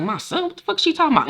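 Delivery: quiet then rising high; whisper-soft then jump up in shock; shocked confusion. Retold or imitated. Fred hearing about his son, soft then high shock. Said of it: "And so he looking like".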